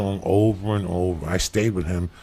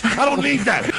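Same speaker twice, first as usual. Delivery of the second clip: angry rant; 14 semitones higher than usual. Real, not acted. Rant continues.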